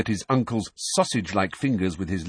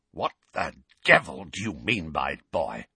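croaky uncle holding back rage; strangled, furious rasp; croaky, trembling fury; 3 semitones lower than usual. A narrator's reading. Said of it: "in a croaky voice that trembled with fury".

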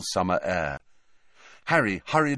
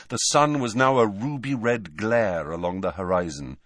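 usual speaking voice

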